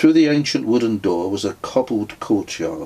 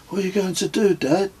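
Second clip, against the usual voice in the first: put-on higher child asking; a worried boy looking up at dad; young son's voice; 11 semitones higher, faster than usual. A narrator's reading. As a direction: son asking dad about the broken rucksack, child voice.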